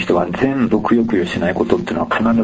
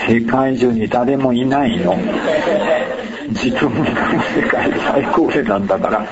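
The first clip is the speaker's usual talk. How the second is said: laughing; 4 semitones higher than usual. Real, not acted. Punchline of his own joke.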